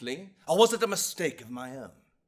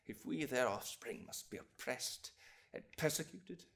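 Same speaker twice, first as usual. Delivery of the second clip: soft, low, measured appeal; subdued courtroom pleading; grave plea; 3 semitones lower, 12 dB quieter than usual. Acted. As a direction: bring it down to a sorrowful hush. Grave plea for persecuted descendants.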